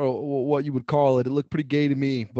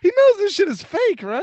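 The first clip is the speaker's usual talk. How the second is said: much higher, louder disbelieving question; high incredulous squeak about the fan; 14 semitones higher, 5 dB louder than usual. Real, not acted. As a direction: ask it in amused disbelief. Mocking a fan who takes wrestling seriously.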